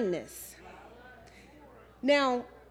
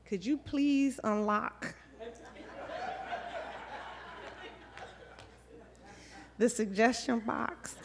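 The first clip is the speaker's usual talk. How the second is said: humbly asking; 3 semitones lower, 8 dB quieter than usual. Retold or imitated.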